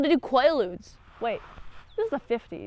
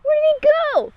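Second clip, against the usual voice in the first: mock high question; 8 dB louder, much faster than usual. Retold dialogue, voiced question.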